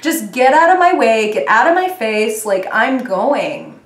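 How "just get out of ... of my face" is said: loud, dismissive get-out; brushing off. Retold or imitated. Voicing reader brushing off petty people, loud. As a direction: wave them off firmly. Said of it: "This month it's like".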